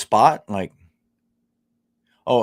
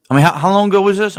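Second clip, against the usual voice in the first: louder and higher, fast and fired up; rapid, raised, incredulous question; exasperated outburst; 7 semitones higher, 9 dB louder, faster than usual. Real, not acted. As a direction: demand it in disbelief. Outraged at chat's story.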